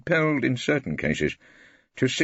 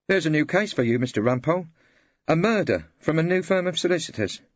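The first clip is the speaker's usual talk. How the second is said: a lightly voiced clerk, higher and pleased; eager clerk; 11 semitones higher than usual. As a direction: bring good news to the barrister. Clerk Henry announcing case.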